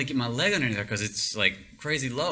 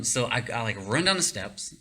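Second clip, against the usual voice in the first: normal talking voice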